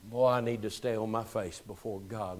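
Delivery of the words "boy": ordinary talk, nothing marked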